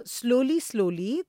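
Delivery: normal talking voice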